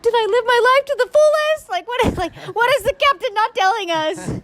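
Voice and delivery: mock panic. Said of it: "I'm, like, literally like".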